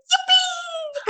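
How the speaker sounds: very high, thrilled squeal of joy; retold scream of delight; excited squeal. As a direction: shriek it like you just got the news. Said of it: "And I literally screamed".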